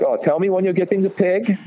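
high-pitched imitation of a delighted chef; mock eager chef. Old chef begs cook for the pig, imitated high and eager.

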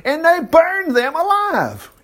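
burned them alive drawn out slow and high; slow, high, appalled stress; dramatic slow emphasis. Real, not acted. Shocked emphasis on horror.

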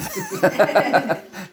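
light high chuckle; amused laugh at the spirit's bluff. Real, not acted. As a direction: laugh at your own joke. Laughs at own bit.

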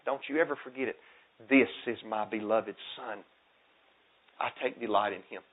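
a hushed retelling of the voice from heaven; hushed reverence. Acted.

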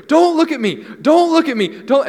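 put-on high, loud, panicked child's scream; kid's shrieking yell. Boy yelling at the teens staring at him, loud high shriek. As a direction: shriek it like a kid in a meltdown. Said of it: "this kid is just screaming over and over".

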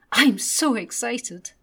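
put-on loud, slow excited little boy; excited child. A narrator's reading. Little Ted waits with closed eyes, loud excited child's voice. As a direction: gush it like a boy holding a present. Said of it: "Little Ted said".

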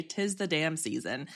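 soft, low [name]; [name] quote. Retold or imitated. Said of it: "as [name] would say".

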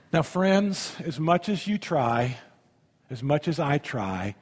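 ordinary talk

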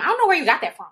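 a mock exasperated relative asking where the crying comes from; family scolding. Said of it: "that's what they used to tell me".

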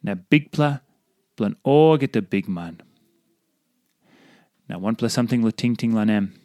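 ordinary talk, nothing marked

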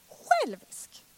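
high, loud, offended cry; indignant outburst. Retold or imitated. Mother retorting to God's rebuke, high and indignant. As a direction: fling the word back, affronted. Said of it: "Jag blev så provocerad".

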